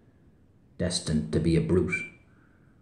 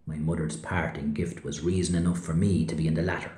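ordinary talk, nothing marked

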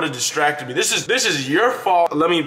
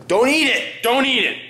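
sharp scold; 9 semitones higher than usual. Real, not acted.

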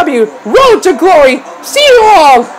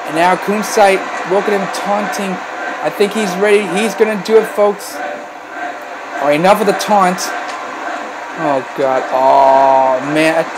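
low, soft resigned commentary; subdued dread; 12 semitones lower, 8 dB quieter than usual. Real, not acted. Commentator watching Kunzite taunt before finishing, hushed dread. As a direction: drop your voice as the end nears.

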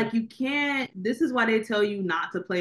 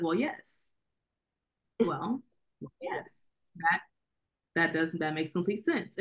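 quiet, flat mock acceptance, trailing softer; deadpan agreement; 2 semitones lower, 5 dB quieter than usual. Reacting to the movie's twist, mild quiet deadpan. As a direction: shrug it off in a hushed deadpan. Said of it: "I was like".

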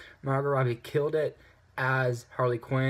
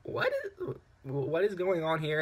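confused; 4 semitones higher than usual. Retold or imitated. Reviewer recalling confusion at the pacing, high baffled question. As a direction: pitch leaps much higher on the first word, then settles. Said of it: "And I'm like".

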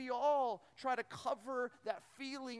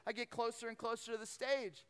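ordinary talk

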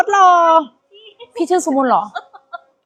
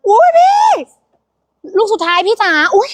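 much higher squeal; surprised squeal; 8 semitones higher than usual. Real, not acted. Host squeals as the last item sells, much higher. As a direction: squeal in delight.

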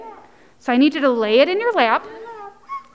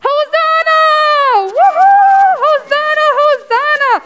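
high-pitched, loud whooping cheer; crowd shouting; 13 semitones higher, 6 dB louder than usual. A narrator's reading. Crowd as Jesus passes by, jubilant shouting. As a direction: shout it joyfully, voice way up. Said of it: "they shouted".

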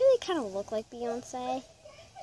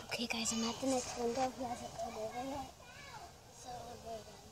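whisper; 10 dB quieter, faster than usual. Real, not acted. Whispering update at drive-through window.

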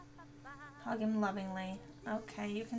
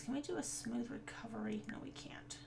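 hushed whisper to yourself; whispered aside; 8 dB quieter than usual. Real, not acted. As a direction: mutter it under your breath in a whisper. Whispering after failed flirt.

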